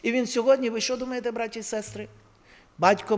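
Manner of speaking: ordinary delivery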